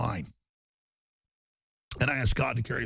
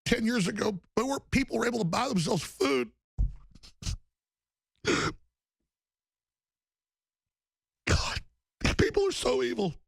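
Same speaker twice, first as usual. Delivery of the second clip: real emotion breaking through; choked up; 8 semitones higher than usual. Real, not acted. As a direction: voice pitched up high, near tears. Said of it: "and then I start crying".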